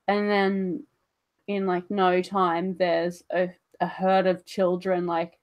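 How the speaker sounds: ordinary talk, nothing marked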